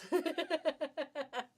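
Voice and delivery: high, rippling giggle; tickled giggle. Real, not acted. Seller tickled by a tongue-twisting fabric name, tickled giggle. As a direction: giggle helplessly at the silly name.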